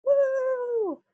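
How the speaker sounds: high, loud drawn-out whoop; celebratory woo. Real, not acted. Knitter celebrating a finished piece, high excited whoop. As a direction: let out a long high cheer.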